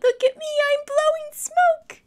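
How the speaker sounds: high, loud little dragon shouting; joyful shout. Acted. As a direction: call out so everyone hears. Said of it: "He shouted".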